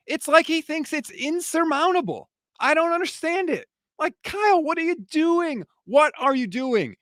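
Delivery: high-pitched pleading at the coach; what are you doing, asked high and exasperated; exasperated disbelief. Real, not acted. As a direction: beg Kyle to explain himself.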